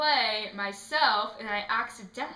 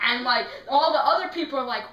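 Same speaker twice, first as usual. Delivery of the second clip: usual speaking voice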